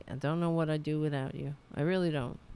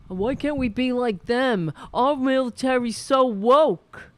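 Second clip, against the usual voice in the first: loud, higher hammed-up outrage; mock right-winger; 7 semitones higher, 11 dB louder than usual. Mocking conservatives on stream, hammed-up loud outrage. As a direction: play a blustering pundit.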